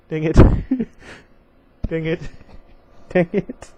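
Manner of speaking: breaking into giggles mid-word; real laughter bursting through; laughing fit. Real, not acted. Reader cracking up at a pun, laughing. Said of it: "I don't know why I'm laughing".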